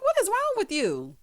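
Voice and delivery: high-pitched, baffled question; mock exasperation. Host acting out bafflement at him, mock exasperation. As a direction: ask it way up high, appalled.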